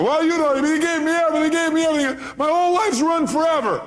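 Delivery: high, loud imitation of a hurt caller; hammed-up distraught complaint; mock anguish. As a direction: wail it like a woman whose life is wrecked. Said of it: "Why is she in pain?".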